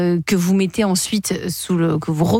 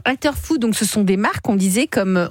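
ordinary talk, nothing marked

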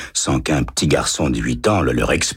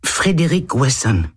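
put-on higher boyish voice, a touch hesitant; little boy voice; 10 semitones higher than usual. A narrator's reading. Boy answering the lieutenant, higher child voice. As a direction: read it as a timid eight-year-old.

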